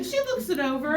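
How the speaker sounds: ordinary delivery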